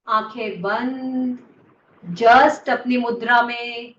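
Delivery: slow, drawn-out meditation voice with long pauses; guided meditation. Real, not acted. Teacher ending the practice for the class, slow guided meditation. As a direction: settle the group into stillness, word by word. Leads closing meditation stillness.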